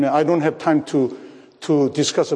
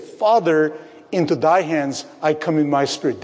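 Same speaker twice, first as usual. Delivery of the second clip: usual speaking voice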